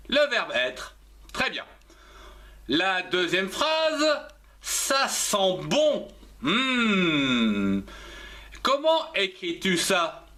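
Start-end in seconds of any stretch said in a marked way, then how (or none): 4.6-7.8 lent et étiré, avec un long « Hmm » qui redescend; déguste l'odeur en traînant sur chaque mot; savourer une odeur. Acted. Acting out savouring a smell. (dégustation lente et gourmande)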